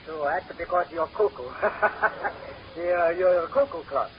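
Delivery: put-on thick comic Italian accent, broken English. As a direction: play the Italian immigrant sidekick with a heavy Italian accent. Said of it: "a little fellow with an Italian accent".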